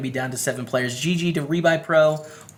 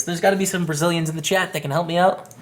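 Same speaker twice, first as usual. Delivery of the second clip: ordinary talk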